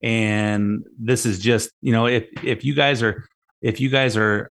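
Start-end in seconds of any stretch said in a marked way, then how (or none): none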